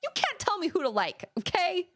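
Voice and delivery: high, defiant mock-outraged retort; indignant comeback. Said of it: "And I was like".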